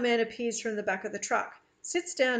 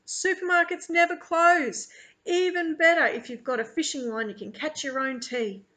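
high, loud boast that settles down; jolly Australian man; 6 semitones higher, 5 dB louder than usual. A narrator's reading. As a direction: brag cheerfully about your country.

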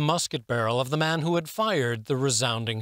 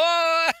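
hearty laugh; 6 dB louder than usual. A narrator's reading. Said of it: "Laughing quite heartily".

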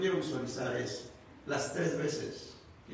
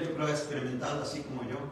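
normal talking voice

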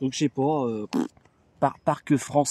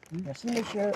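chip tax collector; 5 semitones higher, 7 dB quieter than usual. Pretend. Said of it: "Je suis le percepteur des chips".

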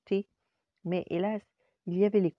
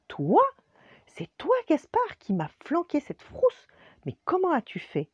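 voix très aiguë et forte de surprise; n'en revient pas; professeur étonné; 14 semitones higher, 7 dB louder than usual. Acted. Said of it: "fut tout étonné". (le professeur découvre Gaspard, très étonné)

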